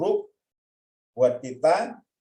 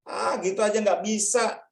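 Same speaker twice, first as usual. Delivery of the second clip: dismissive taunt; 8 semitones higher than usual. Acted. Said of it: "udah dikata-katain".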